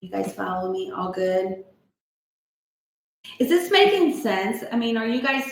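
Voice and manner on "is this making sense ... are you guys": higher, louder eager question to the group; eager check-in. Real, not acted. Host asking the support group if it lands, eager and loud. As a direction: lift it up, really asking them.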